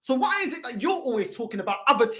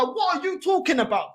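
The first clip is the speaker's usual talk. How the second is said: normal talking voice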